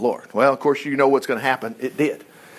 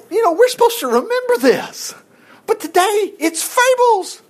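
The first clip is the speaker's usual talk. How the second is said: very high-pitched mocking voice; mock incredulity. Preacher mocking those who call scripture fables, mock incredulous.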